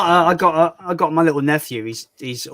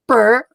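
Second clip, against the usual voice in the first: higher, louder little brr sound effect; mock buzz; 7 semitones higher, 6 dB louder than usual. Imitating the sound effect from his videos, a playful brr. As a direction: blurt it like a cartoon sound effect. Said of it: "It goes".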